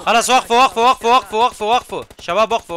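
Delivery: loud urgent yells; shouting. Real, not acted. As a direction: yell at them to stop. Shouting at enemies to stop.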